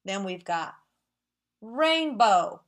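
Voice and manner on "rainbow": "rainbow" in two loud, separate beats; "rainbow" split evenly in two; syllable by syllable. Shown as a demonstration. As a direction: clap out both syllables of "rainbow". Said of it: "It has how many?".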